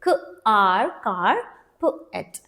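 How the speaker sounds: क, आर joined to कार, then प, एट apart; sounding out. Shown as a demonstration. Teacher breaking carpet for students, क, आर, प, एट sounded separately. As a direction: give each sound its own beat. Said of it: "the rule is also saying this".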